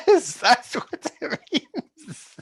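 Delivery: high, slow words squeezed out through real laughter; can barely get it out for laughing. Real, not acted. Laughing at the confusing question.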